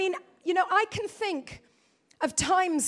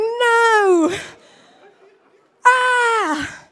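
mock anguished wail; 6 semitones higher, 5 dB louder than usual. Horrified at husband's remark.

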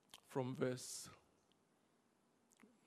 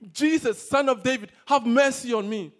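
loud, high cry to be heard over a crowd; full-voiced acted scream for mercy; screaming plea; 5 semitones higher, 13 dB louder than usual. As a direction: yell it out, desperate for attention. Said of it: "And then he's screaming".

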